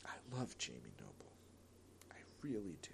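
soft, low, heavy-hearted; sad confession. Real, not acted. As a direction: let the love sound sad and quiet. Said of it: "you can hear the sadness in my voice".

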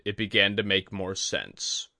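ordinary delivery, nothing marked

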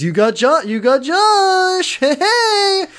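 high, slow dude voice; goofy greeting. Retold or imitated. Kyle greeting Josh, high slow goofy bro voice.

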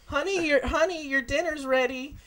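high sweet motherly call; doting mom voice. Pretend. Mother calling son to dinner.

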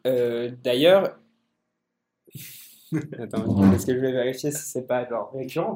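usual speaking voice

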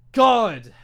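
loud exasperated God; exasperated outburst after ranting at moviegoers. Real, not acted. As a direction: blurt out in frustration. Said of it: "I'm angry".

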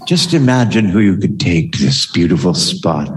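sultry voice. Shown as a demonstration. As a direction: purr it like a perfume ad. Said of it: "we have to do a sultry voice for this".

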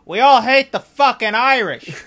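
loud, high hammed-up rant; mock shout. Italian wizard shouting about the Irish, hammed-up yell. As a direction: bellow it like a bitter old bigot. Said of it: "And he goes".

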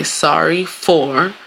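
slow, spelling out each part; slow deliberate apology. Shown as a demonstration. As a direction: lay it out slowly. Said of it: "you would say".

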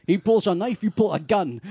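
a mock Connery Irish cop's warning; imitating Connery's Irish accent. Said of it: "Or his Irish accent".

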